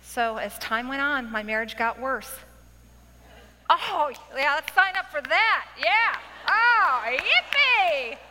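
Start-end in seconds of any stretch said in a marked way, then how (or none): 3.7-8.2 high, loud mock cheering; sarcastic enthusiasm. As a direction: cheer with exaggerated fake delight. Sarcastic cheer about worsening marriage.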